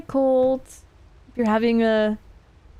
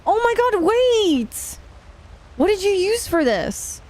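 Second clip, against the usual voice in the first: excited; 12 semitones higher than usual. Real, not acted. Surprise at chat message.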